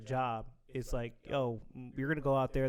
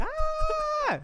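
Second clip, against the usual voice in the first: very high mock scream; comic panicked shriek, not at full volume; Kevin Hart scream; 3 dB louder than usual. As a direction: scream in hammed-up terror.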